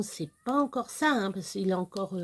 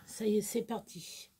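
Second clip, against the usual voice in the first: whisper; 6 dB quieter than usual. Real, not acted. Hushed as it starts.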